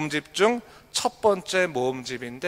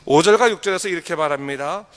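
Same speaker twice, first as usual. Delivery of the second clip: ordinary talk, nothing marked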